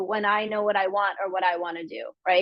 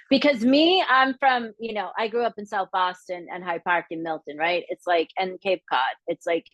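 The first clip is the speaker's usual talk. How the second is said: ordinary talk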